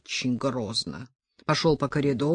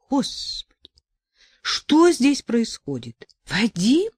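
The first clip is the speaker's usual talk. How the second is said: alarmed surprise; 7 semitones higher, 3 dB louder, slower than usual. A narrator's reading. Sasha bursts in alarmed.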